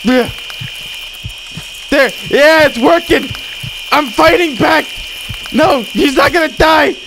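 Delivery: high, loud, triumphant cries; excited shouting. Pretend. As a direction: shout like you're winning back a patient. Excited fight to save patient.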